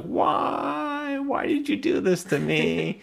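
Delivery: high-pitched mock wailing question; old woman mock wailing; mock scream. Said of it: "she's just screaming like".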